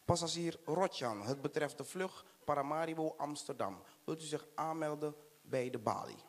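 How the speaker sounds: soft airport desk announcer voice. Acted. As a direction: page the passenger like an airport clerk. Airport announcer paging passenger. (zachte omroepstem op het vliegveld)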